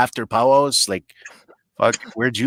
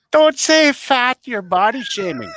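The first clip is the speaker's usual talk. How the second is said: high, slow scolding voice; mock scold; 11 semitones higher than usual. Mocking the fat-shaming police, slow high scold.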